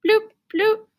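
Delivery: high bouncy bloop noises; playful bloop. Acted. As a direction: pop out two silly bloops. Playful sound effect.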